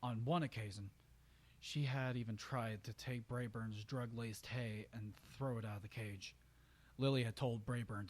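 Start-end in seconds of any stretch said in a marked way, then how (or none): none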